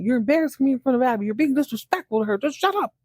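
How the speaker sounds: put-on old man embarrassed by his caretaker; angry outburst. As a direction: tell him off in front of your date. Andrew snapping at caretaker.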